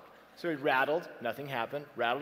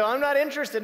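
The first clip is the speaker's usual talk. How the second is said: loud curt refusal; 10 semitones higher, 9 dB louder than usual. Retold or imitated. Homeowner refuses the gospel.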